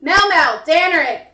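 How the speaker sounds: loud singsong call to the cat. Real, not acted. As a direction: call the pet over. Calling the cat over.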